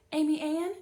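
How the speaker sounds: high, loud call across the library. A narrator's reading. Librarian calls after the girl, loud and high. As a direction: call her name to stop her. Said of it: "Missus Jones called".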